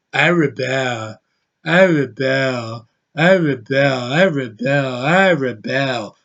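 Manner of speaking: slow, dead, lifeless mock line reading; flat zombie drone. Mocking the actress's trailer line, slow lifeless drone. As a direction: drone it like you're stoned out of your mind. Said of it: "sounding like a freaking zombie".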